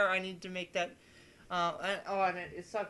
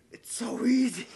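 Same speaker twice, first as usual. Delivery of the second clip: mock exasperation; 5 semitones higher, 7 dB louder than usual. Relief after finding fix.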